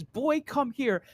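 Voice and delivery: creepy voice. Acted. Imagining the mindscape voice calling Naruto, slow mock creepy. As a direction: lightly mimic a creepy summons, drawn out. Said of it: "The creepy voice says".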